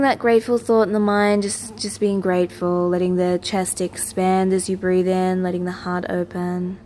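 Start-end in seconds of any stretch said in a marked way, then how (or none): none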